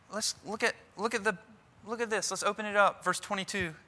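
quiet. Retold or imitated. Pastor calmly answering angry man.

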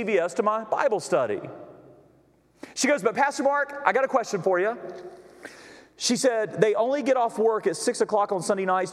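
high-pitched, driving sermon storytelling; impassioned preaching. Retold or imitated. Pastor retelling a church lady's burden to the congregation, impassioned preaching. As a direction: preach her words with urgent fervour.

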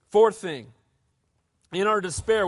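usual speaking voice

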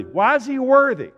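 very loud, high-pitched rhetorical cry; shouted preaching question. Real, not acted. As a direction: shout the question at full force. Repeat escalates to full shout.

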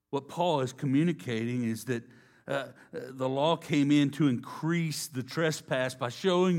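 loud, rising preacher's cadence; preaching at full force. Acted. Preacher declaring to the congregation, loud and rising. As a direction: drive the point home to the congregation.

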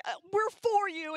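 imitating a whiny teen friend; whiny teen girl. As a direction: whine it like a seventh grader. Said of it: "whiny voices".

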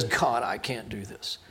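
soft, low, slow confession; quietly overwhelmed, imitated; humble admission. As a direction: drop to a hushed, helpless prayer. Acts out Solomon's humble prayer.

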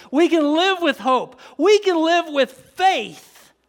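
high, loud, rising preacher's cadence; fired-up preaching. Acted. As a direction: whip the room up. Preaching climax.

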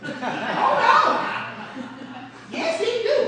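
high, loud laughing insistence; mock-indignant laughing retort; laughing protest. Arguing with husband, laughing.

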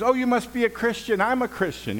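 high, quick, delighted squeal; thrilled to meet a fellow believer; excited delight. Retold or imitated.

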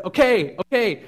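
loud high panicked okays; frantic yelling. Retold or imitated. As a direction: yell it out pacing in panic. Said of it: "he's just going all, all over the neighborhood".

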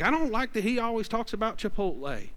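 a hint of a put-out parishioner; mock complaining congregant. Mocking the congregation's grumble about Pastor Joey.

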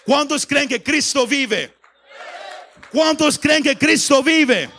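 high, slow, very loud call to the crowd; rising rallying shout. Shown as a demonstration. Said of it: "cómo el tono subió y subió".

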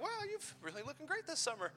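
high, soft mock flattery; mock flirting guy. Pastor mocking a flirty guy, high and soft. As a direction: ham up a smooth-talking guy.

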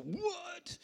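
high-pitched mock shriek of disbelief; acting out a gut reaction, voice leaping way up; visceral shock. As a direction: imitate a stunned, squealed reaction. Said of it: "viscerally just go".

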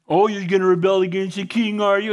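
sneering mock question to Nehemiah; mock them like a scoffer, lightly voiced; mocking accusers. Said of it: "they're mocking them".

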